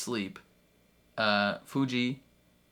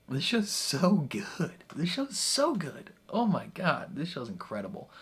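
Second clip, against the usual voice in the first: excited gushing; 7 semitones higher than usual. Real, not acted. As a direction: gush in amazement, pitch soaring. Gushing over the show.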